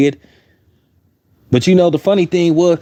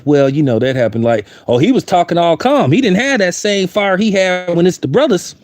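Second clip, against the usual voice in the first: normal talking voice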